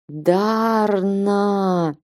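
hollow ghostly call from beyond the grave; muffled voice from the grave; muffled sepulchral voice. A narrator's reading. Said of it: "Приглушённый замогильный голос".

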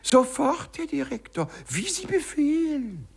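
very high, sing-song fake obedience; mock servility. Clerk mimicking obedience to mock the director, high sarcastic sing-song. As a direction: ape a groveling underling.

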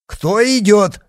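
high, loud, insistent challenge; impatient shout. A narrator's reading. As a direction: demand an answer at the top of the voice. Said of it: "с нетерпением повторил тот же голос".

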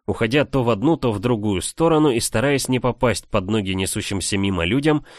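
usual speaking voice

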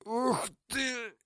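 breathless high wheeze; wheezing. Acted. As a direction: gasp it after a punch to the gut. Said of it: "сипел он".

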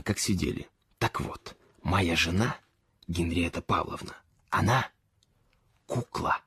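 conspiratorial near-whisper, breath only on the key words; secret whisper. A narrator's reading. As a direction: lean in and whisper the secret about his wife. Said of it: "Я шепну вам что-то".

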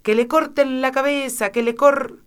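a furious queen screaming the order. A narrator's reading. Queen at Alicia, furious high scream. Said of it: "se puso a gritar".

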